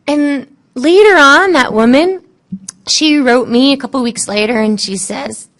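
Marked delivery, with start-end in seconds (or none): none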